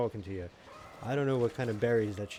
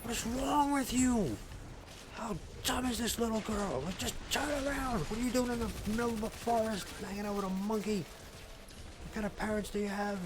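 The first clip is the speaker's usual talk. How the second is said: high-pitched mock tirade, opening on a scream; 12 semitones higher than usual. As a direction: rant at the screen in comic outrage. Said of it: "I'm screaming at the T V".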